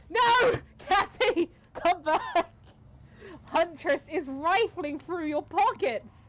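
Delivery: loud, urgent cry; calling out. Acted. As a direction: shout after a thief. Calling out as character.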